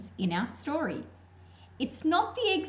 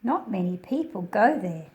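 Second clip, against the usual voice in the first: louder storybook reading, carefully paced; projected read-aloud narration; reading to children; 8 dB louder than usual. A narrator's reading. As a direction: read the picture book out to a class of kids. Reads picture book aloud.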